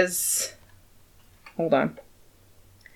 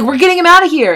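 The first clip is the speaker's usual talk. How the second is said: high, loud acted demand; heated insistence; 10 semitones higher, 7 dB louder, faster than usual. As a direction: insist loudly as Tybalt. Tybalt insists on throwing Romeo out.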